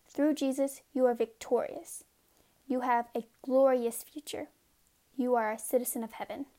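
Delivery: ordinary talk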